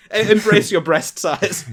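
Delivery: mock-encouraging cheer; cheering Mary on. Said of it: "I'm like".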